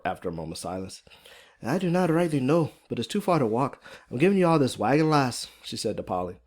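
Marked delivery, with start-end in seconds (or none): 1.6-5.5 higher-pitched Irish woman's voice; thick Irish accent. Acted. Fiona gives Polly the wagon, thick Irish accent. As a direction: lay on a strong Irish lilt. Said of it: "Irish accent is pretty thick and strong".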